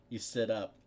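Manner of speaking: ordinary delivery, nothing marked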